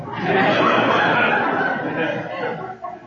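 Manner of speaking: high breathless laugh; airy laugh. Real, not acted. Laughing after the cop breaks it up. Laughing at his own story.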